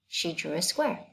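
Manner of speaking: ordinary delivery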